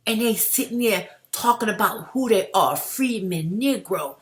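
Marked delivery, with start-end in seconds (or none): none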